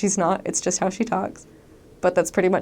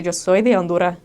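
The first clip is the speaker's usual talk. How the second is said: Honduran Spanish with the final S dropped; Honduran accent; 4 dB louder than usual. Retold or imitated. Someone from Honduras introducing themselves, Honduran accent. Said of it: "don't say the S on the end of the words".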